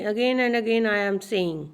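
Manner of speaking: ordinary talk, nothing marked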